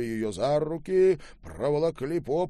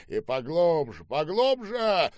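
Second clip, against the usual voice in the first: loud order, second word higher and drawn out; barked command; 6 semitones higher, 6 dB louder than usual. A narrator's reading. General commands his officers, insistent shout. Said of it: "командовал генерал".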